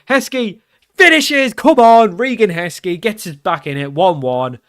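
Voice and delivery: high, loud cry easing into commentary; jubilant goal shout. Real, not acted. Player celebrating Heskey's equaliser, jubilant. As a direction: erupt as the ball goes in, then settle slightly. His team scores equaliser.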